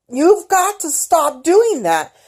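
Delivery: high, loud, slow angry reprimand; mad, slow scold. Retold or imitated. Mother snapping at daughter who keeps bumping her, high and slow. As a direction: drag each word out, fed up. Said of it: "I got mad at her".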